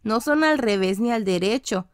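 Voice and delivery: ordinary delivery, nothing marked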